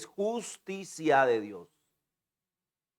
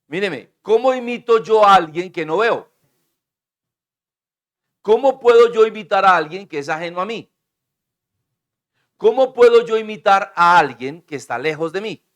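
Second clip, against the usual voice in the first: loud, higher rhetorical questions; preaching at full force; 5 semitones higher, 6 dB louder than usual. Real, not acted. Preacher pressing the congregation with loud questions. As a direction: hammer each question home.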